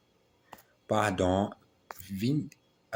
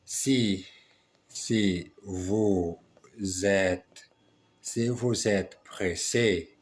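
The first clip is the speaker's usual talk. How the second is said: slow dictation; much slower than usual. Acted.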